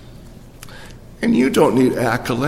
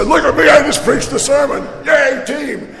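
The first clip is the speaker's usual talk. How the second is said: mock celebration; 9 semitones higher than usual. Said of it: "I spike it and say".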